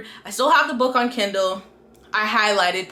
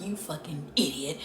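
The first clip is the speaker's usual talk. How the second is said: low, quiet muttered insult; muttered exasperation; 7 semitones lower, 13 dB quieter than usual. Retold or imitated. Reader cursing the heroine who won't confess, muttered exasperation. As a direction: mutter it under your breath, fed up. Retelling her reaction to the heroine.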